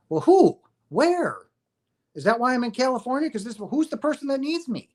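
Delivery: high-pitched mock bewilderment; baffled questioning. Puzzled man answering a call to Texas, baffled questioning. Acts out baffled reply to message.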